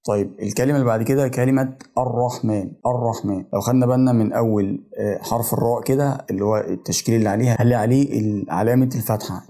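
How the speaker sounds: usual speaking voice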